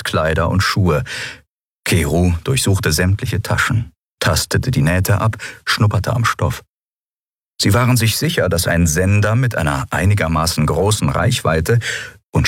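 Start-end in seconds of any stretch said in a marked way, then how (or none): none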